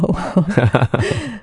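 a high laugh that tumbles down, pleased; high falling laugh. Real, not acted. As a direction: laugh warmly at the compliment. Host says life is a harvest. (听到称赞后高声笑出来)

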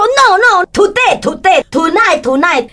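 着急地用英文恳求; panicked pleading. Retold or imitated. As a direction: beg the officer it must be tonight. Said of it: "我说".

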